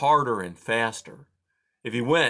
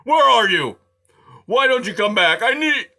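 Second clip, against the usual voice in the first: much higher, much louder desperate call; bellowing call; 12 semitones higher, 10 dB louder than usual. A narrator's reading. Aerith calling Poppy to come back, bellowing loudly. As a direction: yell it out across the rain. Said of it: "he bellowed".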